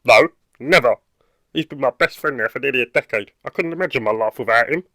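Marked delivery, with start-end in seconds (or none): 0.0-1.0 loud refusal. Acted. Flat refusal to give dog away.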